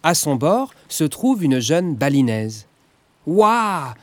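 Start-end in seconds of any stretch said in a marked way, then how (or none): 3.3-3.9 thrilled at their luck; exclaiming. Acted. Sacha exclaims with delight as the colourful boat appears. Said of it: "s'écrie Sacha".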